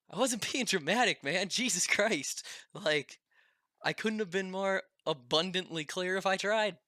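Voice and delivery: imitated friend, fed up but amused; exasperated friend. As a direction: throw up your hands, half laughing. Derek exasperated retort.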